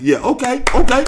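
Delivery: high, loud gloating; loud mock yell; taunting the court. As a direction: shout it at the court. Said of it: "We talking to the court like".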